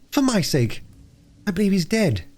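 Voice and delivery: put-on high, worried child's voice; beg, then fear the worst, high-pitched; pleading little girl. Phyllis begs, fearing him dead.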